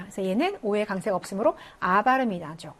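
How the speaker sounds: normal talking voice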